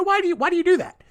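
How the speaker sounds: high, fast mock question; high mocking quote. Host mimics listeners asking why he records ahead, high and fast. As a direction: squeak it out quickly, mocking the askers. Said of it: "some people have asked".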